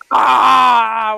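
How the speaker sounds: long, loud mock cat scream; loud screech imitating a demonic cat; imitated cat screech. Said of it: "demon screeching in the hallway".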